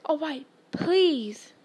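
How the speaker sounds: slow, reluctant giving in; grudging. A narrator's reading. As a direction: drag out the please in exasperation. Filch gives in grudgingly.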